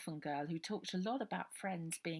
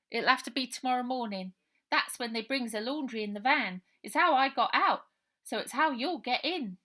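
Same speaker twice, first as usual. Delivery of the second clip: higher, louder cockney lad explaining; matter-of-fact street-wise plan; street boy Robert; 7 semitones higher, 11 dB louder than usual. Acted. Robert telling Ida how to get in via the laundry van, cockney boy.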